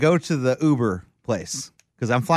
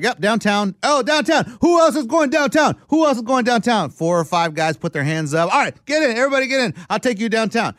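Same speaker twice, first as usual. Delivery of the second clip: cab driver impression; 7 semitones higher than usual. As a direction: hawk fares like an eager cabbie. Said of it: "one guy goes in there and is like".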